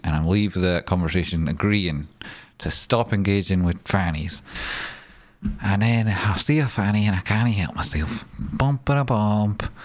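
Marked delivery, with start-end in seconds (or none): 5.6-9.7 a lightly put-on silly voice, the last words slowed right down; mock silly voice. Comic voice for losing self-control.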